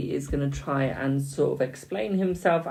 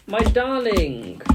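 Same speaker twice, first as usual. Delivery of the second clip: slow, stretched "my darling"; drawn-out endearment; 5 semitones higher, much slower than usual. Real, not acted. Reader fondly drawing out darling to a viewer, slow. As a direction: linger on darling, higher.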